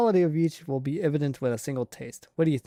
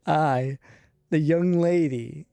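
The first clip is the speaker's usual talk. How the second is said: put-on slow, louder, proud the young lady; haughty young lady; 2 semitones higher, 6 dB louder, slower than usual. Character calling herself the young lady in a louder, slowed proud tone. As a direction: savour the title grandly. Mock-formal self reference.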